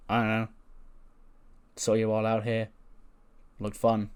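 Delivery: understated, unbothered deadpan with gaps; acted nonchalance. Wrestler explaining why he wandered out, mildly indifferent. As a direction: toss out each phrase with a verbal shrug.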